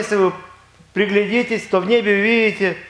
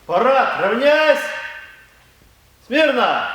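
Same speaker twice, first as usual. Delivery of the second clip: higher, barked parade commands; shouting drill sergeant; 5 semitones higher, slower than usual. Acted. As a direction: bark the orders at the parade.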